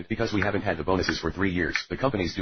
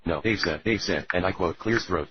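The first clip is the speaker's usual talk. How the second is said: normal talking voice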